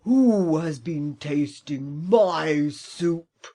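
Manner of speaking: loud, slow, booming bear; great big bear voice. Acted. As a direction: boom it out like a huge angry bear. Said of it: "he cried in a great big voice".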